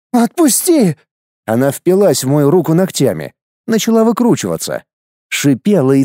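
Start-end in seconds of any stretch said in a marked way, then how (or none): 0.1-1.0 high, desperate female cry; wife's frightened cry. Acted. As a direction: scream to be let go. Wife struggling. (отчаянный женский крик)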